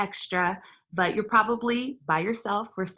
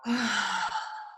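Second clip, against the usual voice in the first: sigh; 4 dB quieter than usual. Shown as a demonstration. Guided breathing release.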